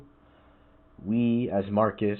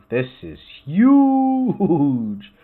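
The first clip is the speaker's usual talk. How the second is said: loud, high, hugely stretched HUGE; drawn-out cry of astonishment; real amazement; 8 semitones higher, 9 dB louder, much slower than usual. Real, not acted. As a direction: stretch it out in a big awed whoop. Amazed at the size of the path.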